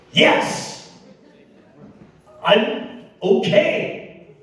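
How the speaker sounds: high, strained, drawn-out retort; exasperated. Retold or imitated. Stuck driver answering a stranger's repeated question, exasperated. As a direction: imitate your own fed-up yell, stretching each word.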